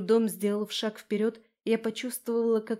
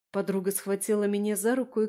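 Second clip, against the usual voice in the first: ordinary delivery, nothing marked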